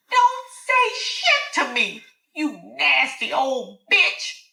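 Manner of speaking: high, slow, drawn-out insult; thundering. A narrator's reading. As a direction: hammer each insult home. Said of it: "she thundered".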